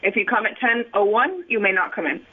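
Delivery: normal talking voice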